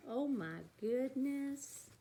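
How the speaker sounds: ordinary delivery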